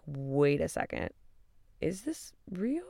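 drawn-out, hushed incredulous question; stunned disbelief. Retold or imitated. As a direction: quietly question whether it can be true. Stunned reaction to being dumped.

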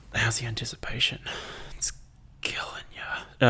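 teasing stage whisper. Real, not acted. Teasing listeners before the story.

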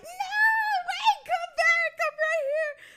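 loud, high, drawn-out call after a car. Retold or imitated. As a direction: yell after him to come back. Calling after brother's passing car.

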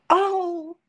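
high, loud panicked oh; frantic friend. Retold or imitated. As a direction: imitate the panicked friend on the phone. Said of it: "she calls me frantically".

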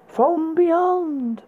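high, drawn-out spooky title announcement; horror host. Acted. Announcing next film title.

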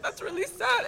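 a mock-weepy high squeak of a TV-watching mom; imitating mom tearing up. Recalling mom watching CNN, imitating her high teary voice. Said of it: "and she's like".

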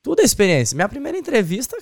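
normal talking voice

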